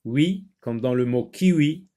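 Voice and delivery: ordinary talk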